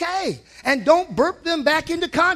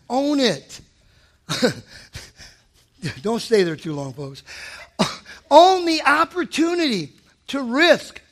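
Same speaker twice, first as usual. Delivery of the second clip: normal talking voice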